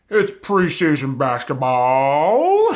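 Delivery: loud, drawn-out hype with a long final shout; hyped-up announcement. Real, not acted. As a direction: stretch the last word like an arena announcer. Hyping next week's first game.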